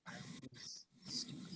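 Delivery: breathed out, barely voiced; ashamed whisper. [name] admitting his mistake to Amelia, whispered. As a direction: admit it under your breath.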